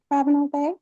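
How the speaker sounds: usual speaking voice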